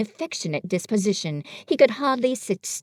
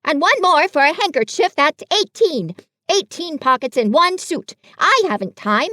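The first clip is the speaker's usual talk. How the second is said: higher, louder fussy Rabbit voice; bossy Rabbit; 6 semitones higher, 6 dB louder than usual. Acted. Rabbit lecturing Pooh and Piglet, fussy and loud. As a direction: Rabbit laying out the problem with fuss.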